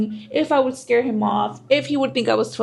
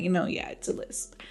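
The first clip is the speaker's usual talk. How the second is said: whispered aside; 5 semitones lower, 10 dB quieter than usual. Retold or imitated. Admitting the lisp to herself.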